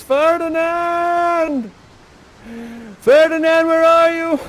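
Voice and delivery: high, loud, long-drawn call into the distance; calling for Ferdinand. Acted. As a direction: shout for the lost son across the island. King calling for lost son.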